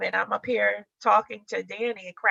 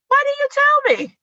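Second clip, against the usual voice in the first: much higher and louder, squealing astonishment; shocked discovery; 9 dB louder than usual. Retold or imitated. Wife messaging Danny on learning his brother, shrieking shock. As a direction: shriek the question in disbelief.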